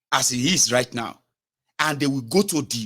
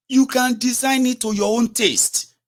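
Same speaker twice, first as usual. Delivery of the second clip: higher, louder and slow, a sneering parody of the TV pundit; mocking the pundit; 10 semitones higher, 4 dB louder, slower than usual. Vlogger mocking a TV pundit's claim, slow and high. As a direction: ham up the pundit's claim, slowly and pitched up. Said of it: "He confidently went on Nigeria T V".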